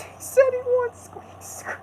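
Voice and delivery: very high squeal fading to a whisper; squeaky cartoon voice.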